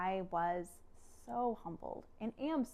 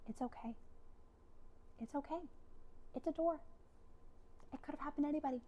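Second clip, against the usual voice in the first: soft, higher, gentle husband voice; merciful reassurance; 6 semitones higher, 9 dB quieter, faster than usual. Retold or imitated. Husband forgiving wife over the broken door, gentle reassurance. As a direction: reassure softly, in short spaced phrases. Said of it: "just mercy".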